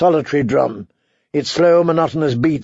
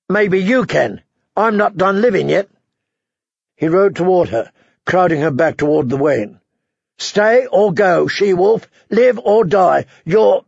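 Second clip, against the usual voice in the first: raised, forceful warning; harsh, scornful warrior; 4 semitones higher than usual. Acted. Warrior warning the girl off the castle, harsh and scornful. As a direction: throw the grim warning at the child, pitched up. Voices the Hound.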